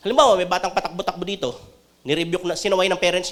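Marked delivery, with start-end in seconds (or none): none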